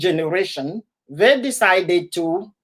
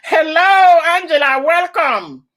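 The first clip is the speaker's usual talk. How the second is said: bright greeting; 7 semitones higher, 4 dB louder than usual. Real, not acted. Greeting a viewer joining live.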